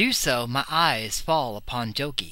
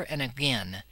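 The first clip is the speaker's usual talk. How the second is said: ordinary delivery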